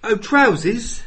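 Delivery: high, loud mock English; hammed-up fake English accent; bad English accent. Joining in mocking Americans, bad English accent. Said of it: "trying to do an English accent".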